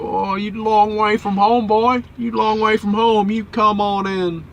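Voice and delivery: high, loud, slow Southern drawl; hammed-up Southern guard, slow and high; mock American border guard. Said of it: "they go".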